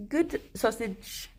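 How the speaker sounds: slow, soft praise for a pet; gentle slow pet praise; tender praise. Real, not acted. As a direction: coo it slowly to the bunny. Praising the rabbit softly.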